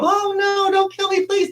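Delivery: high, hammed-up pleading of a horror movie victim; mock movie victim. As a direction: squeal it like a cheesy film victim begging. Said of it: "in the movies, they always go".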